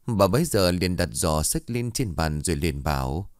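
plain reading voice

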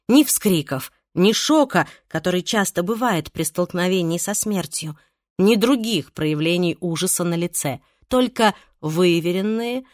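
ordinary delivery, nothing marked

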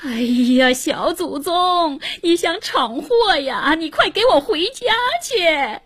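stretched-out, high-pitched pleading scold; scolding mother. A narrator's reading. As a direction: cry out in motherly alarm and reproach. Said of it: "只听刘母喊道".

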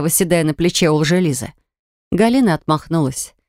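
usual speaking voice

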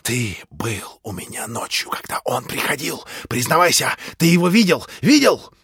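frantic demand. A narrator's reading. Ivan demands a confession.

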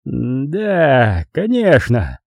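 drawn-out, wavering agreement; heavy, lingering assent; sighing resignation. A narrator's reading. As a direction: give in as if after a long sigh. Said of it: "долгий душераздирающий вздох".

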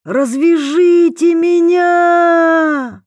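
very loud high drawn-out cry; desperate scream. A narrator's reading. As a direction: scream it after the cart. Prisoner screams after cart.

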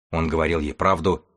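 usual speaking voice

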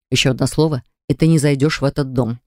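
usual speaking voice

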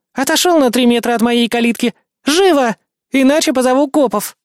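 high, forceful female-character command; angry threat. A narrator's reading. Hostile woman threatens narrator at gate.

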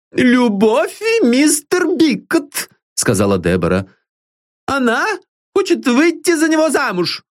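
put-on high, pointed old maid's voice; old servant woman. Old servant tells the father about the girl's love, high and pointed. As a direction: spell it out for the master.

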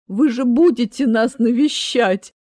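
loud, slow tearful plea; в слезах. A narrator's reading. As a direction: wail it mock-tearfully, drawn out. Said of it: "лил слёзы Аид". (громко и плаксиво, нараспев)

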